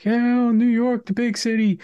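loud, high New York swagger; mock New Yorker boasting; New York accent. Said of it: "he has that proper New York accent".

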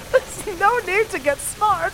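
put-on a young girl turning down a stranger, prim and high; wary girl. Girl refusing the stranger's ride, high and prim. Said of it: "so here are the lyrics".

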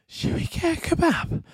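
put-on high, breathy drunk plea for a kebab; drunk friend begging. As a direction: wheedle like a tipsy friend. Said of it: "and you were like".

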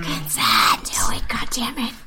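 breathy whispered plea; whispering. Real, not acted. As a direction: whisper it into the mic. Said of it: "whisper it into the mic".

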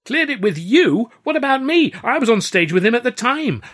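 loud, high outraged protest; indignant outburst. Acted.

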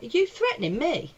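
high-pitched, incredulous challenge; retold indignant challenge. Comic squaring up to an angry punter, indignant challenge. Acting out her confrontation.

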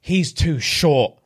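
each word dragged out; slow deadpan rejection; slow blunt verdict. Retold or imitated. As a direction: deliver it slowly, like a verdict. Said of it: "she just hit him with the".

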